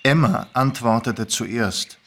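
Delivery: ordinary delivery, nothing marked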